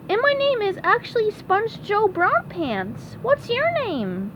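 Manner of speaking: high, innocent cartoon voice; oblivious SpongeBob. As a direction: introduce yourself in a high cartoon pitch.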